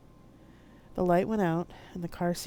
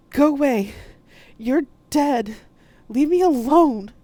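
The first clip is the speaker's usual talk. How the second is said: loud, high frightened yell; shouting; 10 semitones higher, 13 dB louder, slower than usual. Acted. Poe screaming at her dead mother's ghost. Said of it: "I shouted at her".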